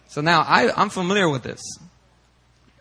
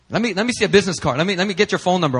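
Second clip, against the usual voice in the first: fast, higher-pitched demand; imitated pushy officials; insistent policemen; 6 semitones higher, 3 dB louder, much faster than usual. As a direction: pester for details, hurried. Police demanding his card, acted.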